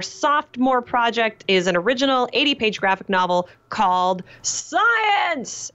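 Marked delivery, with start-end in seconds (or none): 4.8-5.7 Science said high and exclaimed; Science! punched up high like an exclamation; exclamation point. Shown as a demonstration. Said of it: "with an exclamation point on the end".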